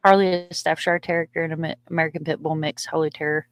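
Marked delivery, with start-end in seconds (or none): none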